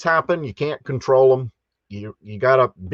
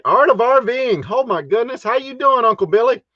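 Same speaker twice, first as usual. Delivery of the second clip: excited greeting; 9 semitones higher than usual. Real, not acted. Greeting chat viewers excitedly.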